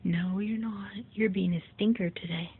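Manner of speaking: usual speaking voice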